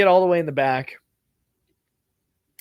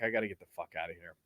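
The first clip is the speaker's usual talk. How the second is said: quick low mutter under the breath; muttered thought; 8 semitones lower, 14 dB quieter, much faster than usual. Retold or imitated. Bored traveller muttering to himself, a quick low mutter. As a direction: mutter it fast to yourself.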